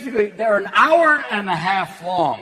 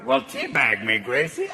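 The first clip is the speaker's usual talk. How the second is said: George Burns; 8 semitones lower than usual. Retold or imitated. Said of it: "George Burns going".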